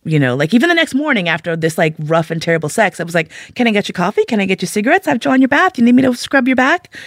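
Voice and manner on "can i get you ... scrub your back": high, quick string of offers; mock-devoted fussing; eager to serve. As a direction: play the fawning servant, pitch climbing with each offer. Acts herself fussing over lover.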